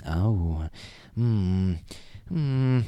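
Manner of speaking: lightly voiced, drawn-out pondering; musing hums in a put-on voice; Jeff Goldblum. Brain starting to tick over, pondering impression. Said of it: "I'm doing a Jeff Goldblum impression".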